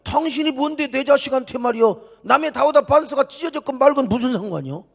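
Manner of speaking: high-pitched indignant protest; acted parent's outraged objection; offended parent. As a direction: confront a stranger for scolding your child. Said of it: "아버지가 얼마나 기분 나빠요".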